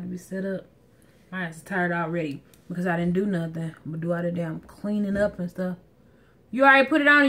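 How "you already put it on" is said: raised voice across the yard; calling out. Real, not acted. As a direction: call out to someone outside. Calling out to husband at grill.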